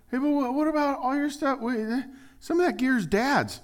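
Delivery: high, anxious protest; a fisherman protesting to the brothers about Dad's gear, high and anxious; worried fisherman. Acted. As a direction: fret about the family gear. Acted fisherman worrying over gear.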